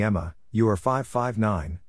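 normal talking voice

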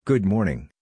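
normal talking voice

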